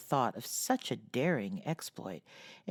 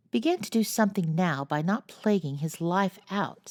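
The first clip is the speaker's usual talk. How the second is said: a sharp, higher, louder rebuke; 6 semitones higher, 5 dB louder than usual. A narrator's reading. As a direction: cut him off with a mild scold. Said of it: "said Meg sharply".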